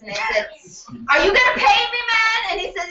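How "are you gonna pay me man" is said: high, loud, incredulous demand; indignant challenge. Retold or imitated. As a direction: call him out on his nerve. Doctor challenging the rushed patient.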